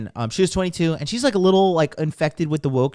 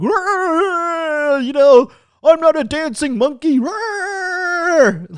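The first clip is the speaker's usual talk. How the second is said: much higher, loud whiny crying shout; mock tantrum; 14 semitones higher, 7 dB louder, much slower than usual. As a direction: whine it like an outraged man-child. Mocking the mgtow community.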